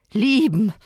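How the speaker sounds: one word dripping with scorn; scornful. A narrator's reading. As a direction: throw the word back mockingly. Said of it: "so höhnisch, wie ich das Wort nur".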